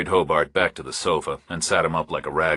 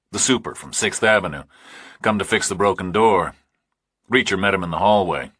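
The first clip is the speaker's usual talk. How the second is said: usual speaking voice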